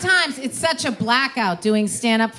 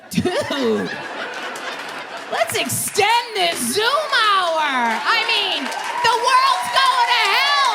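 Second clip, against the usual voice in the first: excited plea; 10 semitones higher, slower than usual. Retold or imitated. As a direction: mock-desperate plea to stay on the call. Acted quote, pleading to continue.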